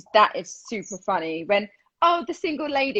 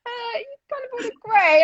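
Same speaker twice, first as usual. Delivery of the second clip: whiny imitation; 12 semitones higher than usual. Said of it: "you were like".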